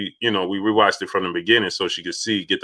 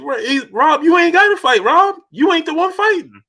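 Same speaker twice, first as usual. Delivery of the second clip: high, loud, keyed-up retelling of a boxer telling his trainer; imitating a fired-up fighter pleading with his coach; hammed-up boxer telling his trainer he isn't the one fighting; mock excited insistence; 4 dB louder than usual. Quoting Floyd to Robert Garcia.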